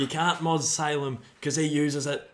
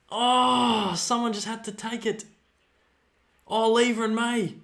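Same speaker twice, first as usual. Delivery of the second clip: anxious excited calls; 8 semitones higher, 4 dB louder than usual. Real, not acted. Passage of play continues.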